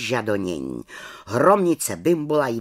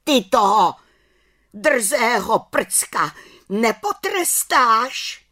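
higher, louder, slow indignant jeer; spiteful witch Bimbula; 10 semitones higher, 5 dB louder, slower than usual. Acted. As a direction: demand punishment with spite. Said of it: "se ozvala hromnice Bimbula".

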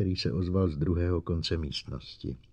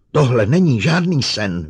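loud, high hysterical outcry; forceful cry of denial; 5 semitones higher, 10 dB louder than usual. A narrator's reading. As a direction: shout it in rising panic. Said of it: "vykřikla".

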